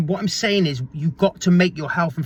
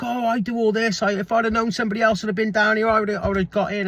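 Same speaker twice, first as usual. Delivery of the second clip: higher voice for the dog walker; imitating the lady; 6 semitones higher than usual. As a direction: play the lady at the sea, higher. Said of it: "she was like".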